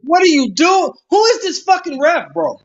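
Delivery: yelling from the sidelines. Real, not acted. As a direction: yell at the screen like a fan. Yelling at the ref on screen.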